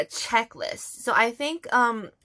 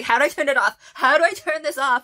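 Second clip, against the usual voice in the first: louder, slightly higher flustered asking; mock panic; 5 semitones higher, 8 dB louder, faster than usual. Idol can't stop the bubble gun, mock panicked. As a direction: play his bubble-gun panic lightly. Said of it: "he starts panicking".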